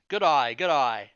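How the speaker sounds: loud, slow demo of an Australian accent; "good day" turned into "good eye", Australian style; mock Australian accent. Shown as a demonstration. Player teaching everyone an Australian accent, mock Australian "good eye". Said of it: "that's an Australian accent".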